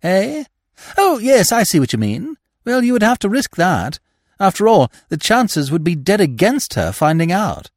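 put-on eager young gentleman. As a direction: pitch the scheme like a keen young toff. Freddy voiced again.

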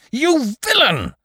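high, loud, drawn-out scream of hatred; hateful cry. A narrator's reading. Grieving Lupin attacking Holmes, screaming with hatred. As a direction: scream the accusation with sudden fury. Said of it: "in an access of hatred".

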